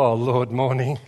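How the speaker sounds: low, slurred half-awake mumble; groggy non-morning person. Retold or imitated. Husband greets God half asleep, groggy low mumble. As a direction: drag it out like you just woke up. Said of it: "I wake up and go".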